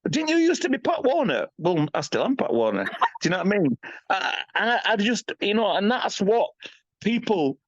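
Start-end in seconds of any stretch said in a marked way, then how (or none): 0.1-1.5 a much higher, teasing fan voice; mock fan voice. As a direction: pitch it way up, mocking the people who ask. Quoting people who meet him.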